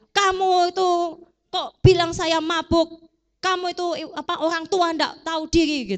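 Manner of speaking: hammed-up angry rebuke of a rude woman; mock angry scolding. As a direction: scold the old priest in mock outrage. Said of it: "dia nggak marah".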